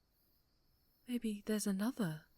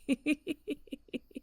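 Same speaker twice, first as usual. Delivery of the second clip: sinister laugh; 7 semitones higher than usual. Acted. Villain gloating over violence.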